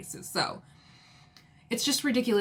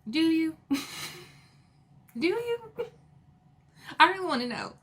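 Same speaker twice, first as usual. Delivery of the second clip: sarcastic, pitched way up; sassy mock interrogation, pitched high; mock challenge; 8 semitones higher than usual. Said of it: "And I'm like".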